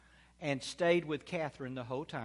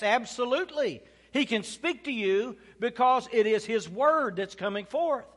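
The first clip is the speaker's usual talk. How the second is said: raised-pitch, loud sermon delivery; emphatic, high-pitched preacher; preaching; 7 semitones higher, 6 dB louder than usual. Real, not acted. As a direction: proclaim it with conviction to the congregation. Preaches at full force.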